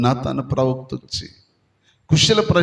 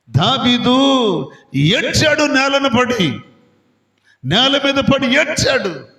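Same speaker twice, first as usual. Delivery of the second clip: fervent preaching; 9 semitones higher than usual. Acted. Preaching at full force.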